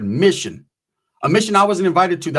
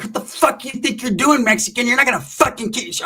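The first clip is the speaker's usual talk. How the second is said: imitating her snarling turn, pitched up; mock Featherwood rage; 7 semitones higher than usual. Melanie turning on him, mock higher snarl at the Mexican. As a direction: play the sweet girl gone full Featherwood. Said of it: "she turned from straight sweet, nice girl to straight Featherwood".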